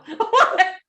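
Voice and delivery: high loud burst of laughter. Real, not acted. Cracking up at her own joke with Tina. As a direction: crack up at your own line. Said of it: "laughs at own joke".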